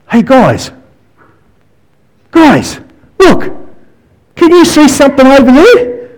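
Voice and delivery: high, eager call to the crew, pitch leaping on look; excited call. Retold or imitated. Peter calling the disciples during the storm, excited and high.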